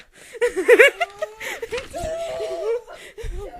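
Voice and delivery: high, loud, helpless howl of laughter; shrieking laughter. Real, not acted. Reader howling with laughter mid-sentence. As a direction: collapse laughing.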